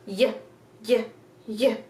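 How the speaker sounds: short isolated "y", like "yuh"; letter Y sound. Shown as a demonstration. Teacher showing the sound Y makes, short isolated sounds. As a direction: separate brief "y" sounds with gaps. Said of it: "Y makes a y, y, y sound".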